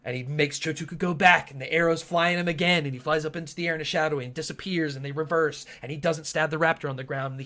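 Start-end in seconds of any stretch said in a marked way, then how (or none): none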